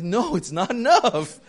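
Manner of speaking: loud, high, drawn-out comic retort; slow it down and exclaim it, amused; mock blunt refusal. Friend bluntly rejects the offered payment.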